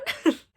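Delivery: short high giggle, real amusement; sudden delighted laugh; high burst of laughter. Real, not acted. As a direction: laugh out loud at the joke. Laughs at the reveal.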